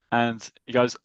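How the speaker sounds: ordinary delivery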